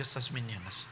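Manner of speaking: butter him up gently; sweet-talking. Retold or imitated. Wife flattering her husband, soft and coaxing. Aunt sweet-talks uncle.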